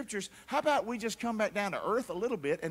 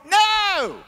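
mock crowd answer; 12 semitones higher, 11 dB louder than usual. Preacher answers his own question.

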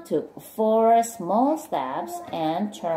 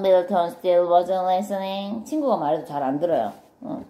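ordinary delivery

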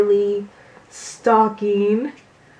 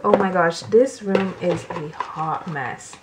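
ordinary talk, nothing marked

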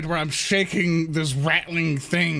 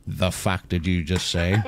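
put-on low, menacing threat to the child; menacing growl; 11 semitones lower than usual. [name] kneels and menaces the child, low and threatening. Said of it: "and I go".

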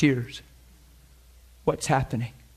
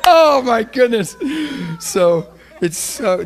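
higher, louder, slow laughing sigh of joy; laughing delight; 8 semitones higher, 8 dB louder, slower than usual. Real, not acted. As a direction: savour it through laughter.

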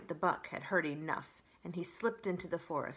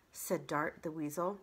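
plain reading voice

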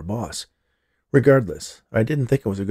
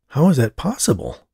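higher-pitched, disbelieving question; incredulous; 6 semitones higher than usual. A narrator's reading. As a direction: ask it as if you can't believe it.

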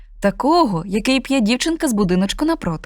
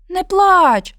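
high, louder little snowman voice; snowman soothes; 10 semitones higher, 5 dB louder than usual. Acted. Snowman comforts the crying cat, high small voice. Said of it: "заспокоїв рудого сніговичок".

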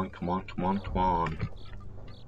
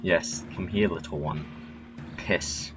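breathy whispered call; 4 semitones lower, 10 dB quieter than usual. Real, not acted. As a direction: hiss the name to lure him. Luring a target by name.

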